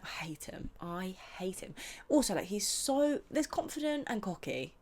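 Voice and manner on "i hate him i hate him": breathy whisper of disgust; whispered hate. Real, not acted. About Ben on Love Island, whispered disgust. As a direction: hiss it under your breath.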